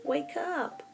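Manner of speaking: put-on high small-girl voice, pressing; urgent whisper-wake. A narrator's reading. Little Anna urging Elsa to wake. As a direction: coax her sister out of bed. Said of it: "she said urgently".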